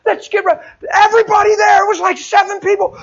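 high, loud revival preaching; fiery testimony preacher voice; preaching at full force. Acted. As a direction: preach it to the rafters, shouting.

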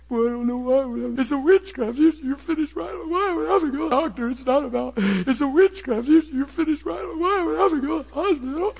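high, whiny, tearful pleading; mock-pitiful high sob-talk; pitiful crying voice. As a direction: whimper it like a man in pain pleading. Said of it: "I'm pitiful".

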